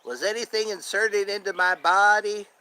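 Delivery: put-on suspicious questioning; Dale Gribble. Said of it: "the voice for Dale Gribble".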